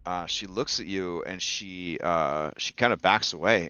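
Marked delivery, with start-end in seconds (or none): none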